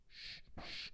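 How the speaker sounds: breathy shh to keep a secret; hushing whisper. Retold or imitated. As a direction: shush them, whispered.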